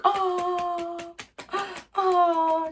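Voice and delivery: high, drawn-out impressed oh; impressed crowd gasp. Retold or imitated. The cast reacting to the apology, impressed oh. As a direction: gasp like the whole room just got won over. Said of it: "And everybody's like".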